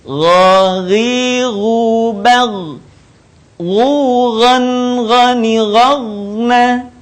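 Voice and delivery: Ghaghiru chanted very slowly on held notes; thick Gha drill. Shown as a demonstration. Teacher models an example, slow sustained chanting of Ghaghiru. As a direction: thicken the gh and stretch Ghughan, ghani, ghagna. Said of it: "harus terdengar menebal suaranya".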